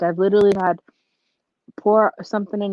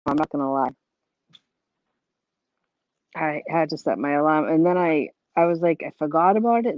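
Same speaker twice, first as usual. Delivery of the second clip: usual speaking voice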